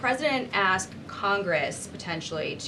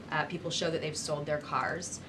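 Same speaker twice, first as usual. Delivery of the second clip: usual speaking voice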